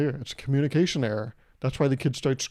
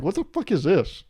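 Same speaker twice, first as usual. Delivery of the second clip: a higher, louder mock outburst of disgust; shocked disgust; 5 semitones higher, 4 dB louder than usual. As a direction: recoil at the taste like it betrayed you. Retelling tasting whiskey now.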